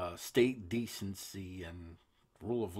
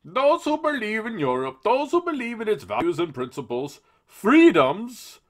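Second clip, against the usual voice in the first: much higher, louder cartoon politician; silly Juncker voice; 12 semitones higher, 11 dB louder than usual. Host reads the EU president's quote in a silly voice. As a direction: lampoon him with a goofy pompous voice. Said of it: "make him sound silly".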